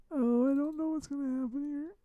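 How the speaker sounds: high, soft voice; high uneasy murmur. Real, not acted. Streamer unsure what the software will do, high uneasy murmur. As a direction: fret softly up high. Uncertain while fiddling with stream.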